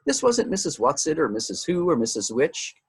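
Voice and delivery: usual speaking voice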